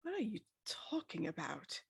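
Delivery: ordinary delivery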